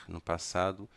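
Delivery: normal talking voice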